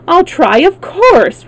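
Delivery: high, loud eager reply; keen, obliging character voice. A narrator's reading. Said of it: "replied the Wheeler eagerly".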